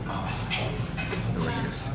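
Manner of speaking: ordinary talk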